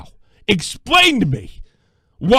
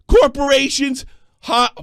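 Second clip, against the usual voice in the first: usual speaking voice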